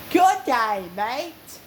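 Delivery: put-on light Australian accent greeting; a breezy Aussie "G'day, mate". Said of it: "That's a good Australian accent".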